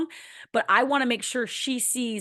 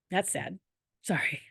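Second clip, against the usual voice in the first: 1 choked-up hush; 7 dB quieter than usual. Real, not acted. Mother gets emotional, apologises.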